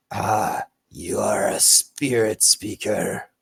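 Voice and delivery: slow, measured lizard-folk voice, words drawn out; solemn lizard folk. Acted. Lizard folk recognises Vesh as spirit speaker, slow and solemn. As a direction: speak slowly as a reverent reptile hunter.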